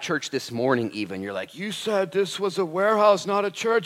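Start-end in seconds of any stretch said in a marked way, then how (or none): 1.5-3.9 high-pitched mock protest; put-on complaining churchgoer. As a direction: imitate a tough local objecting in a raised pitch. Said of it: "a bunch of rough, tough, and gruff people".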